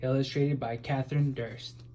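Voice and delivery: ordinary delivery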